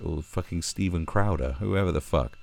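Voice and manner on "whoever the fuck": usual speaking voice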